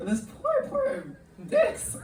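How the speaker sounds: high, squeaky fake sob; hammed-up tearful squeal; mock weeping. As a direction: pretend to cry in pity, pitch way up. Mock grief over the dicks.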